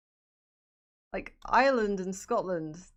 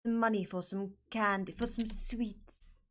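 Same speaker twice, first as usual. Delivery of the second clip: put-on British accent. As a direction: ask mummy sweetly in a put-on British accent. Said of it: "Speak like this".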